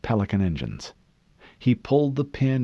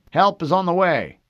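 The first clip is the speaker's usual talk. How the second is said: higher, louder distant radio voice; 10 semitones higher, 7 dB louder than usual. A narrator's reading. Fleet voice on the radio promises help, higher. Voice returns through static.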